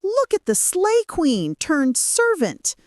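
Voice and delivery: high-pitched mocking jeer; villagers' scornful taunt. A narrator's reading. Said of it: "The villagers mocked her".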